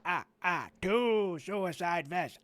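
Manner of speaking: mocking a Sesame Street figure, with chanting bits; hammed-up caricature with silly chants; mock character voice. Comedian mocking a Sesame Street figure in a hammed-up voice. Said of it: "the only character I can make fun of".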